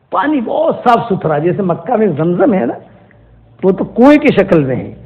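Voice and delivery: ordinary talk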